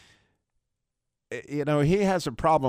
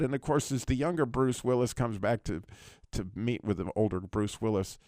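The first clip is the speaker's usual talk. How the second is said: ordinary delivery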